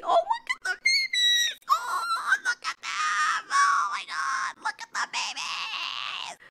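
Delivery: very high, slow, squeaky squeal of delight; squealing over babies. Real, not acted. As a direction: gush over the cute babies in a tiny high voice. Cooing over newly hatched babies.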